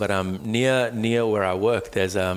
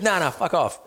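higher, rude dismissal; mock angry brush-off; 8 semitones higher than usual. The driver telling him to get lost, mock rude. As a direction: toss it off like a rude worker. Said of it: "He goes".

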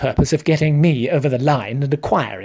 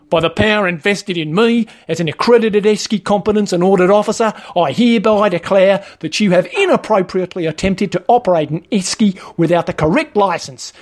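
higher, pompous official pronouncement; Wayne, officious trainer; 4 semitones higher than usual. Acted. Trainer fining a learner for mishandling an esky, officious. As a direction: play the self-important petty officer.

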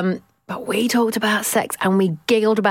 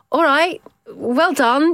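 mildly cross mock congratulation, pitched up; sounding cross; 7 semitones higher, much slower than usual. Parent answering acquaintances boasting about school exams, sounding cross. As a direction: congratulate them with a touch of irritation. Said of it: "Now I'm sounding cross".

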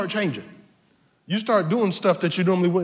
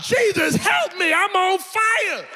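burning prayer cry; 8 semitones higher, slower than usual. Retold or imitated. Believer crying out from the perm burn, mock yelling. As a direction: wail for help, hammed up, higher and higher. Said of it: "You start to say stuff like".

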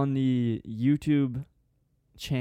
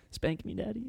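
mock sultry voice; 8 semitones higher, 7 dB quieter than usual. Mock sultry line after scold us.

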